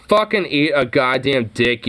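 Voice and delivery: ordinary talk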